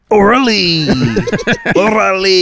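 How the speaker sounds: high, hammed-up Mexican accent. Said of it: "I exaggerated because that's what an imitation is".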